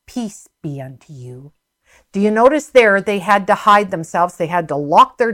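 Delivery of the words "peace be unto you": hushed, low words of Jesus; gentle blessing. Acted. Preacher voicing Jesus greeting the hidden disciples, gentle blessing. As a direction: lower and soften right down to give peace.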